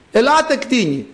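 high-pitched acted summons; calling out. God summoning the beasts, calling out. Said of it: "Φωνάζω".

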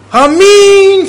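Amen cried out high and loud; shouted Amen. Retold or imitated. Bedridden sister answering the priest, a loud high shout. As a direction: cry out Amen with all your heart. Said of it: "φωνάζει".

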